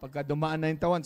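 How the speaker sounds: normal talking voice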